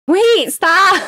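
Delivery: high, loud stop cry; panicked shout. Real, not acted. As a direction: yell to halt it now. Panicked call mid fight.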